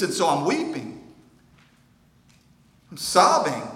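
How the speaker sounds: a rising, anguished sermon cry; impassioned lament. Acted. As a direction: preach the lament at full force. Jesus' lament at full force.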